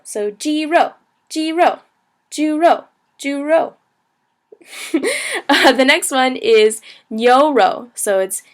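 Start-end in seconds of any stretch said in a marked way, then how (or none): 0.3-3.7 "jiro" and "juro" high level, then falling; first and fourth tone. Shown as a demonstration. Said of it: "also a first tone, fourth tone".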